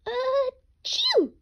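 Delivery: very high, loud cartoon sneeze; loud high-pitched sneeze. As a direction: sneeze big like a little rabbit. Rabbit sneezes in den.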